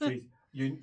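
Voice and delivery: normal talking voice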